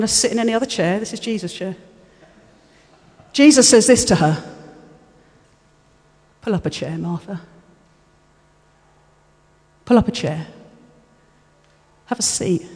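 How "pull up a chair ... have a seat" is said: low, soft, tender invitation; loving invitation. Acted. Jesus invites anxious Martha to sit, low and lovingly. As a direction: speak gently and quietly, with room between phrases. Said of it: "he looked at her and he loved her".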